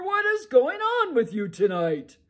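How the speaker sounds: a sleepy giant ogre grumbling to his wife; loud ogre brushing off his wife's worry. Acted. Ogre annoyed.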